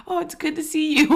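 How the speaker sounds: high, bright friendly greeting; friendly shop owner. Retold or imitated. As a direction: play the cheery shop guy. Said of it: "the dude was like".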